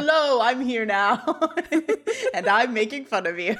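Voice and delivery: put-on loud, teasing kid-sister announcement with laughter. Little sister barges in to make fun, cheeky and loud. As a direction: butt in like a pesky little sister. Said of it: "it feels very kid sister to be like".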